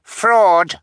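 put-on high loud baby shout; shrill baby shout; baby cry. A narrator's reading. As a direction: yell it like an outraged baby. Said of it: "Sunny cried".

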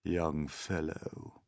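slow, soft, withering grasshopper; deep, slow, scornful. A narrator's reading. Old grasshopper puts down the Centipede, scornfully. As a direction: draw it out with quiet scorn. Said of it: "speaking in a deep, slow, scornful voice".

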